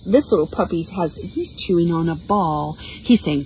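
usual speaking voice

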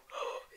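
quick startled gasp; sudden frightened gasp; sharp gasp. Real, not acted. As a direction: suck in a breath in alarm. Spotted by a mob.

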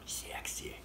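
breathy mock-sultry whisper. After coughing he jokes his cough is sexy, whispered. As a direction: tease it under your breath.

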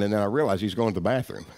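normal talking voice

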